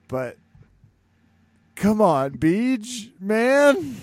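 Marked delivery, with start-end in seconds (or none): 1.7-4.0 high, loud exasperated plea. Acted. Cohost pleads with B J Penn to stop fighting, high and loud. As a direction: beg him to quit, fed up.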